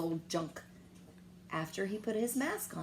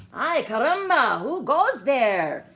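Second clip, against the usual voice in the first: louder, higher, slow challenge; challenging call; 6 semitones higher, 9 dB louder, slower than usual. A narrator's reading. Skippy challenging the Chihuahua band, loud and slow. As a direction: call out boldly into the dust. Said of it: "said Skippy John Jones".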